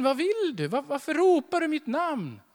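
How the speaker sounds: a lightly voiced wife, confused and a bit annoyed; puzzled wife. Wife runs in again, puzzled.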